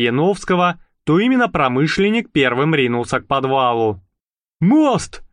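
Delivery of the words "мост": breathless. Acted. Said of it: "тяжело дыша".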